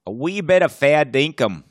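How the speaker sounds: mangled fake Australian accent; mock Australian. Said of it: "our accents aren't gonna carry us".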